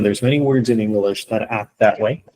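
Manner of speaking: ordinary talk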